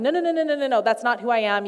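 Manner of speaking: high, rapid-fire protest; hasty denial. Acted. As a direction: cut in quickly to set them straight. Imagined hasty denial by Jesus.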